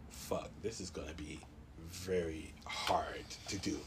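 low, slow whisper; whispered dismay. Retold or imitated. Patient told he must give another sample, whispered dismay. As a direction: mutter it slowly under your breath. Retold dismay at nurse's demand.

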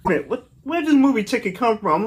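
incredulous. Real, not acted. Baffled at the gift choice.

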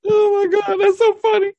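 high shrieking laughter. Real, not acted.

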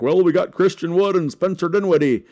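lightly voiced, a hint of a clueless front office; clueless front office shrugging off the question; mock team voice. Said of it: "Why are you talking in that voice".

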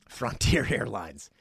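chuckling through the name; laughing. Real, not acted. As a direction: laugh mid-word.

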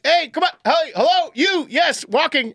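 high, repeated calls across a lot; yelling to get attention. Retold or imitated. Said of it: "everyone was yelling at her, me included".

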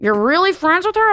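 repeated mock disbelief with rising pitch; high questioning echo, imitating a doubter; incredulous question.

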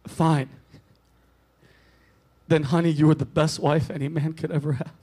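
a frail man saying goodbye from his deathbed; dying old man. Acted. Dying man's farewell.

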